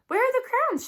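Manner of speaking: high, eager question; eager carriage lady. Acted. A lady calls from her carriage window, eager and high. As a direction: lean out and ask eagerly.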